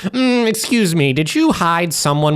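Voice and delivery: high nasal know-it-all voice; nerd voice. Pretend. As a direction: push it up into a prissy nerd. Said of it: "in your nerd voice".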